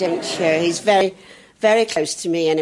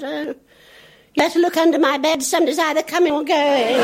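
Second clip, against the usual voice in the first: put-on little girl; 10 semitones higher than usual. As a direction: warn like a small girl.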